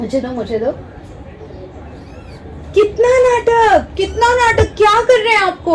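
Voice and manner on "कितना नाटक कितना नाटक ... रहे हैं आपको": high sing-song pet talk. Real, not acted. Baby-talking to the fussing kitten.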